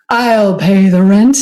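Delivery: stride in as the dashing hero; heroic rescuer. Acted. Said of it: "and a hero".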